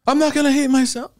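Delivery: high, mock-defiant I'm not gonna hate myself; defiant self-talk. Arguing back at his own self-hate, mock defiant. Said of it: "fighting and arguing back".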